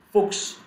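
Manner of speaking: "Fuchs" said as "fooks"; "Fuchs" as a separate demonstration word; chs as ks. Shown as a demonstration. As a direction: model "Fuchs" ending in ks. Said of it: "going to be pronounced as x".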